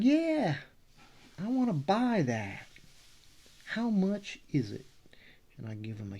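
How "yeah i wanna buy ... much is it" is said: high, loud, hammed-up Texas drawl; Middle Texas accent. Imagined fan asks to buy the art, loud Texas accent. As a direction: whoop it like an eager Texan. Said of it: "he's, uh, he's really from Middle Texas".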